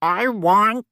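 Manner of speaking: talking through a mouthful; mouth full. Acted. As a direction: say it with food in your mouth. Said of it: "Don't talk with your mouth full".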